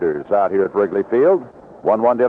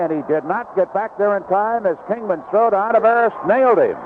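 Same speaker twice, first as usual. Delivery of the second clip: higher, building excitement on the play; excited call; 6 semitones higher than usual. Real, not acted. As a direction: call the rally as it unfolds. Base hit, runner thrown out.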